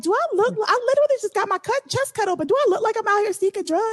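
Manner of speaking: high, quick incredulous retort; indignant protest. Retold or imitated. Patient to nurses offering fentanyl, indignant protest. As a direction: pitch it way up in mock disbelief.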